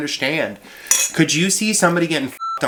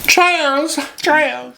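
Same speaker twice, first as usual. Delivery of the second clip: high, loud Nova Scotian [name] call of Chaz; belting Chaz in a Nova Scotian [name] voice; [name] with a Nova Scotian accent; 4 dB louder than usual. Shown as a demonstration. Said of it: "say Chaz with a Nova Scotian accent in [name] voice".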